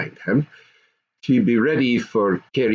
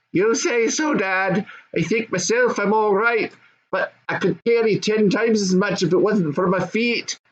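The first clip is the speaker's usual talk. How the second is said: put-on high young goblin son; 10 semitones higher than usual. Goblin son answering his dad, high and eager.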